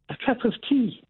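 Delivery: high, marvelling "a cup of tea"; amazed realization. Retold or imitated. Telling the host about the boss, amazed at the tea. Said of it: "I thought".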